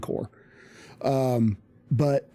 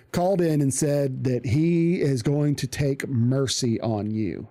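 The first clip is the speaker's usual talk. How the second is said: ordinary talk, nothing marked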